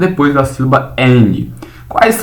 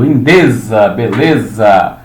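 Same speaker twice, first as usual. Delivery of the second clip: slow example word; 3 semitones lower, slower than usual. Shown as a demonstration. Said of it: "teacher models the word".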